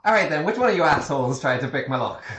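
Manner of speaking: a deliberately bad Irish accent, lightly voiced; gruff ship captain in a hammy Irish lilt; worse Irish accent. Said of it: "That was a worse Irish accent".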